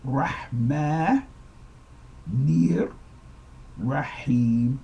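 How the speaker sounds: Rahma, nir, Rahim split with pauses; syllable-by-syllable linking. Shown as a demonstration. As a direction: move the n of Rahman onto "nir". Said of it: "You do not pronounce that N sound twice".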